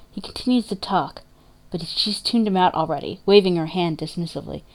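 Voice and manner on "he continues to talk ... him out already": usual speaking voice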